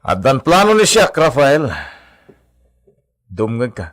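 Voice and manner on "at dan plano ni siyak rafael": raised voice. Acted. Heated argument over the villa.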